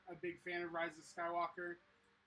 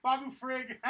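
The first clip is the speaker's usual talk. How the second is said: laughing; 4 semitones higher, 9 dB louder than usual. Real, not acted. Excitedly recalling a favorite character.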